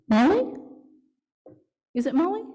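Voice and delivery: high, loud call toward the screen. Real, not acted. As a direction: call her name to reach her.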